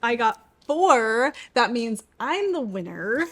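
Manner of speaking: normal talking voice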